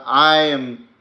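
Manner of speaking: ordinary talk, nothing marked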